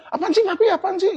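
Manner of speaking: high-pitched imitation of an annoyed kid; child refusing a hug. Father imitating his child who refuses his hugs, high-pitched protest. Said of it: "sekarang saya peluk aja kagak mau".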